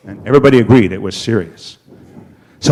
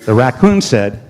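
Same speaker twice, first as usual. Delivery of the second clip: ordinary delivery, nothing marked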